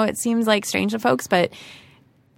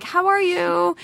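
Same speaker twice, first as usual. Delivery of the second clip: sweet high greeting; 13 semitones higher, much slower than usual. Pretend. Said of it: "I'm like".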